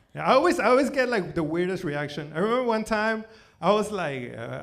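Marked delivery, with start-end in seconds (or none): none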